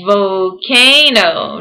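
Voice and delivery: stretched slow volcano; said slowly. Shown as a demonstration. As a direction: draw out "Volcano" slowly, sound by sound. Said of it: "We gotta say it slowly".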